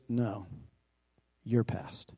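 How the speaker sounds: hushed, low, gentle spirit saying "your past"; soft low spirit voice. Acted. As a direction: murmur "No, your past" like a ghost far away. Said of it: "The voice was soft and gentle, singularly low".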